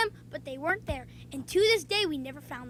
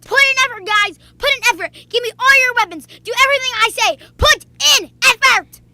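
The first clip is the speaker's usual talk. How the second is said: loud bossy yelling; yelling teammate; 7 semitones higher, 11 dB louder than usual. Retold or imitated. Obnoxious teammate yelling orders at the team, loud. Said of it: "He was yelling at us to put in effort".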